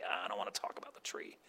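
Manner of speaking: low, hushed reluctant whisper; sheepish whisper. Retold or imitated. Adam dodging his descendants' question, hushed whisper. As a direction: mutter it, not wanting to talk. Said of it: "tell us about the tree".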